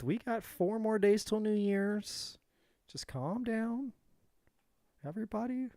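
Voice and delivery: mock soothing calm-down. To upset Gemini viewers, a hammed-up soothing calm-down. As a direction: talk everyone down like a hammed-up therapist.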